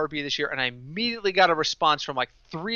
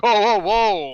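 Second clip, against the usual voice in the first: loud, higher mock-panicked whoas; alarmed backpedal; 5 semitones higher, 6 dB louder, slower than usual. Team reacting to sponsor pulling out, mock alarm. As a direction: blurt it out like a caught-out team owner.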